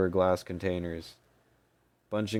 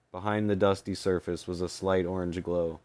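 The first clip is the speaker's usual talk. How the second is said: plain reading voice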